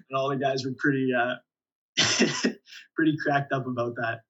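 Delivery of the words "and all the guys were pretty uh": ordinary delivery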